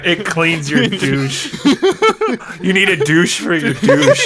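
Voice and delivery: slow, laughing repeat of the joke; amused, laughing and taking it slowly. Real, not acted. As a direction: draw it out through laughter. Cracking up at the bit.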